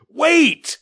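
loud high call; shouted. Acted. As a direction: call out loudly. Father calling after son.

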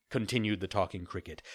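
plain reading voice

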